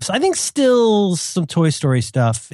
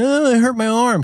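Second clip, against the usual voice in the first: higher-pitched mock whine; whining complainer; 7 semitones higher than usual. Mocking a film bully's complaint, whiny. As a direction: whine it like a hurt brat.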